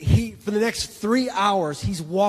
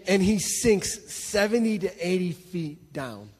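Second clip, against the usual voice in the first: ordinary talk, nothing marked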